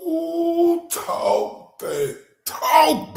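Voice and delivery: drawn-out, high hype call; hyped up. Real, not acted. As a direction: cheer the speaker on, stretched out. Hyped reaction to clip.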